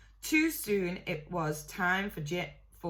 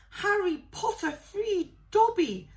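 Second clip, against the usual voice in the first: high, louder squeaky elf voice; shrill elf; 13 semitones higher, 5 dB louder than usual. A narrator's reading. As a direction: gush it up at Harry. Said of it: "said the elf shrilly".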